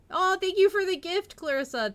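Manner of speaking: high, loud, gushing thank-you; bright excited gratitude. Real, not acted. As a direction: squeal your thanks. Thanking viewer for gift.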